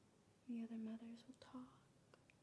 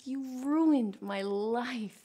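dramatic; 20 dB louder, much slower than usual. Acted. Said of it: "That is dramatic".

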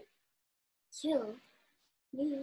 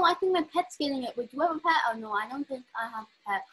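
ordinary delivery

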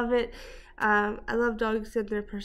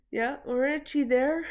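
usual speaking voice

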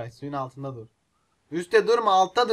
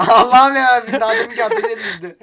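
high, loud helpless laughter; laughing hard; 7 semitones higher, 8 dB louder than usual. Real, not acted. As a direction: laugh through the words. Laughing at player in fire. (kahkahayla gülerek)

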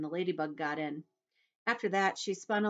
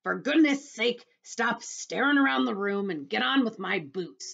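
loud, higher, exasperated demand; impatient centipede; 6 semitones higher, 7 dB louder than usual. Acted. Centipede hurrying James with his boots, loud and impatient. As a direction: order him on loudly.

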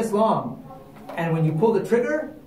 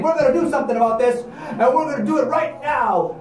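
angry mob; 8 semitones higher, 4 dB louder than usual. Acted. Angry mob declaring action.

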